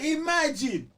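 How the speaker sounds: high, loud laugh on the word. Real, not acted. Laughing at the price.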